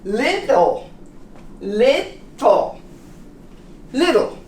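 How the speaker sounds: Little with a flapped t; little demo. Shown as a demonstration. Teacher drills Little for students, repeated. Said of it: "How about little?".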